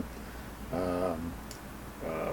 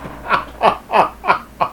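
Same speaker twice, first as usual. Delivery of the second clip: laughing; 9 semitones higher, 3 dB louder than usual. Real, not acted. Host laughs at read joke.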